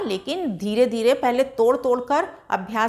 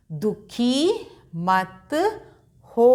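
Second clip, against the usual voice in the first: दुखी मत stretched very slowly; slow reading; much slower than usual. Shown as a demonstration. Teacher reads दुखी मत slowly for pupils to follow. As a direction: read it syllable by syllable. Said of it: "बोलकर अभ्यास करिएगा".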